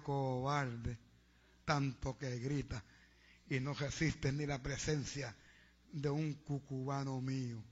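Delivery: low, slow voice of God; rebuking God. Acted. God rebukes the would-be zealot. (voz de Dios, grave y lenta, reprendiendo)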